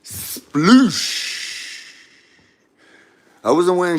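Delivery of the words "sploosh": long hissing sploosh sound; stretched-out water sound effect; imitated splash. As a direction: draw out a breathy splash noise. Imitates bee dropping into water.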